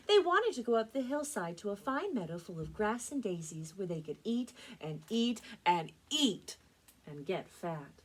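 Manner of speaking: lively, emphatic picture-book reading; storytime narration. A narrator's reading. Librarian reads a fairy tale to children, lively storytelling. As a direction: read it with big, punched key words for children.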